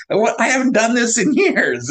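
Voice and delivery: high-pitched mock disbelief; retold amazement. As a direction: act out being amazed at yourself. Retold astonishment after transplant.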